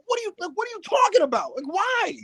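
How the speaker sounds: fast, high exasperated shout at his partner. Retold or imitated. Woods snapping at Kingston, high fast exasperated shout. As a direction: blow up in disbelief. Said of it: "he's fucking exasperated".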